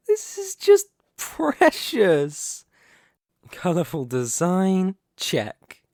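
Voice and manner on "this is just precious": high, slow, sneering amusement; mocking. Villain sneering at the business proposal, high and slow. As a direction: savour how pathetic this is.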